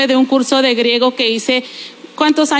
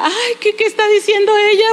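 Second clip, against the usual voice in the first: high-pitched mock scandalized voice; scandalized listener; 7 semitones higher, faster than usual. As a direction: imitate a shocked critic, high and hurried. Imitates a scandalized viewer. (imitación de oyente escandalizada)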